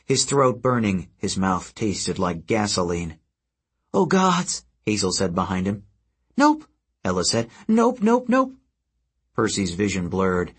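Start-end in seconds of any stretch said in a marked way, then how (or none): 3.9-4.6 much higher, alarmed; alarmed gasp. Acted. Hazel sees Percy double over, alarmed. 6.4-8.5 very high, squeaky, rapid; panicked nope. Acted. Ella the harpy panics, high and squeaky.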